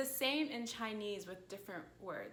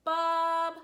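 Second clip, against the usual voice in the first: "Bob" sustained loud on one high pitch; high flat tone; 7 semitones higher, 9 dB louder than usual. Shown as a demonstration. Teacher showing tone one, "Bob" high and level. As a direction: call "Bob" high with no rise or fall. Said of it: "the same sound".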